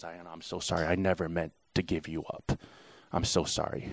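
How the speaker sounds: soft, low, near-whispered apology; tearful apology. Retold or imitated. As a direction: let the voice drop as if tearing up. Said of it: "starts tearing up and says".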